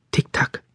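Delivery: a whispered clock tick, voiceless and quiet; soft ticking. A narrator's reading. Narrator voicing the cuckoo clock's soft tick. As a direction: click it out softly like a small clock. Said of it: "macht die Uhr".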